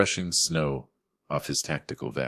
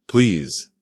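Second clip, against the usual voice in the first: louder, higher desperate plea; begging; 5 semitones higher, 8 dB louder than usual. A narrator's reading. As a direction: beg for help loudly. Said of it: "Vance begged".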